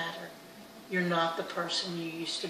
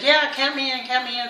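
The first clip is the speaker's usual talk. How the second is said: louder, higher-pitched chiming in; eager joining in; 5 semitones higher, 8 dB louder than usual. Retold or imitated. Friends asking to join the group jog, eager. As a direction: jump in keenly, voice raised.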